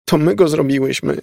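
put-on high, tearful child's wail; pitiful sobbing. A narrator's reading. Elizabeth confesses they made it, sobbing. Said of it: "szlochała żałośnie Elizabeth".

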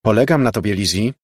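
a touch of hardness; hard voice. A narrator's reading. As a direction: say it firmly, as the boss. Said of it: "powiedziała twardym głosem Margo".

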